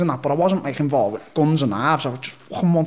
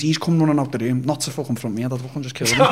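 ordinary talk, nothing marked

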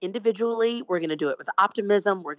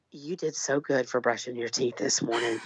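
lower, softer tender praise; self praise; 8 semitones lower, 6 dB quieter than usual. Retold or imitated. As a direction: coo it like praising a child. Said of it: "to just tell yourself".

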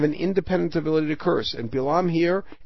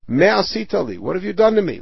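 higher, louder accusing cry; indignant protest; 5 semitones higher, 4 dB louder than usual. Acted. Balak turning on Bilam, higher and louder in protest. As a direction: throw the blame back hard.

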